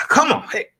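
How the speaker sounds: hype-man call and count-in; rowdy party animal. Pretend. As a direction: pump up the crowd, party style. Hyping himself up after sermon.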